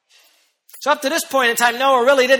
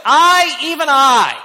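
I, even I hit high and loud; thundering claim of credit; emphatic; 6 semitones higher, 6 dB louder, slower than usual. Acted. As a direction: lean hard on I, even I. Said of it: "how emphatic he is".

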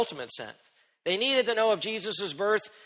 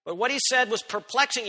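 ordinary delivery

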